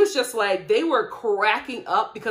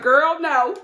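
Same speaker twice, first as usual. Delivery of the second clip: friend's blunt no; 5 semitones higher, 8 dB louder than usual. Retold or imitated. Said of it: "She's like".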